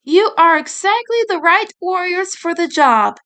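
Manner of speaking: lightly voiced proud fairy. Rin addresses the girls.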